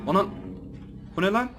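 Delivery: high, loud startled cry; jolted by a jump scare; shocked shout. Real, not acted. Gamer hit by jump scare, crying out in shock. Sudden scare in horror game.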